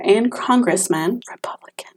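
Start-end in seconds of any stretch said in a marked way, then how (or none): 1.3-1.9 a snide whispered aside. Real, not acted. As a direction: whisper it like a juicy secret. Aside about senator father.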